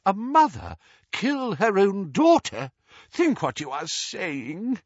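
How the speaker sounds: high, appalled plea; anguished old friend objecting; groaning protest. A narrator's reading. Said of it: "groaned Doge".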